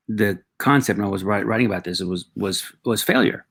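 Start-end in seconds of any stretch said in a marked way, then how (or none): none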